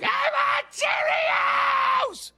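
put-on shrill high toddler wail, slow; very high drawn-out mock toddler cry; whining toddler. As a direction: throw a tantrum like a toddler in the store. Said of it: "they're like".

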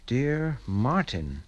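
slow, measured read with a falling end; slow reading aloud. Acted. Father opens a mysterious letter and reads, slowly and deliberately. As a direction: read it out word by word, letting it drop.

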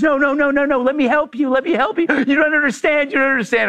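high-pitched, frantic imitation of begging; hammed-up pleading; mock frantic pleading. Woman begging to help.

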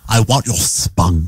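low, slow mock Cockney; awful Cockney. Co-host reprising the spunk joke, low exaggerated Cockney. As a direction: drop the pitch into a fake Cockney. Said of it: "You got the awful Cockney".

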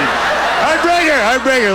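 proud dad laughing. Retold or imitated. Proud dad repeating it to friends, laughing. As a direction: savour the word through a laugh. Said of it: "They're like".

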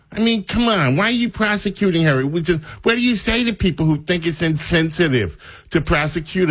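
high whiny mock reporter voice. Radio host mocking a reporter's question, whiny and high. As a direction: sneer it in a high put-on voice.

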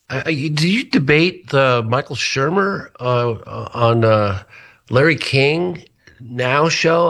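imitating a friend. Friend's phone call retold.

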